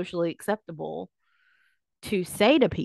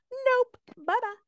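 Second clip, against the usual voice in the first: very high mock farewell; chirpy refusal. Fleeing at any sniff of burnout, high chirpy dismissal. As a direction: pipe it up high and bail out. Acting out her instant flight.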